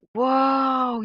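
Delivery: high and loud amazed whoa. Retold or imitated. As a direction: blurt it out in wonder. Friend sees bear, amazed.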